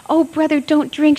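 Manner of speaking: high, loud desperate plea; frightened cry. A narrator's reading. Little sister begs brother not to drink, high and loud. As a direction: cry out to stop him. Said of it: "she said".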